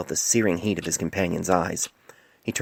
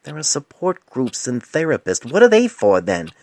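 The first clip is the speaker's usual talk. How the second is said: rising, louder outburst of indignation; tired, indignant retort; 8 semitones higher, 7 dB louder than usual. Acted. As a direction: snap back, exhausted and fed up. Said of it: "fueled Painless's indignant response".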